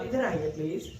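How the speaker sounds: soft, polite plea; polite request. Shown as a demonstration. Teacher shows a polite request to come here. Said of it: "For example, वैसे".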